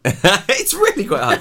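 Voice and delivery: laughing while talking. Real, not acted. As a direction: giggle through the words. Laughing at failed accent tries.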